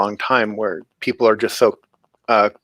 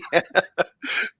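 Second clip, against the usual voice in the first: soft laugh; 6 semitones higher, 4 dB quieter than usual. Real, not acted. Laugh after own remark.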